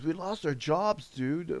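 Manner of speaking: high-pitched imitated French accent. French coworker breaking news of lost jobs, imitated French accent. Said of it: "in a French accent".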